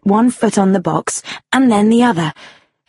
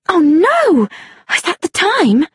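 high, alarmed exclamation; dismayed cry; 10 semitones higher than usual. A narrator's reading. Woman seeing her watch, crying out in dismay. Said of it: "she cried".

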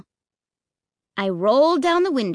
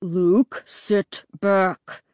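growling; much slower than usual. A narrator's reading. Dad has had enough of son, growling. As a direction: growl each word at the kid. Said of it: "Dad growled".

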